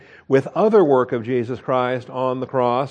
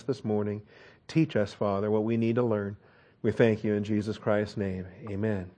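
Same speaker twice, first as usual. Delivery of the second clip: soft, reverent prayer voice fading lower and quieter; pastoral prayer; 3 semitones lower, 4 dB quieter than usual. Acted. Pastor praying quietly for the congregation, reverent. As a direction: pray humbly before the congregation.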